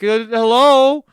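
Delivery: high, drawn-out call to spirits; mock ghost hunters. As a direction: call out high and loud, coaxing whoever is hiding. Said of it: "just have people like".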